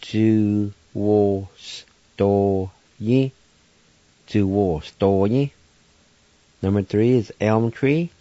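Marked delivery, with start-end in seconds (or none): 0.0-3.3 Ju-wo-sto-yi stretched out very slowly, syllable by syllable; teacher drawing out Ju-wo-sto-yi; very slow pronunciation. Shown as a demonstration. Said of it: "And now I'll pronounce them". 4.3-5.5 Ju-wo-sto-yi said slowly but flowing; careful Ju-wo-sto-yi for learners; slower pronunciation. Shown as a demonstration. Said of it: "And now I'll pronounce them".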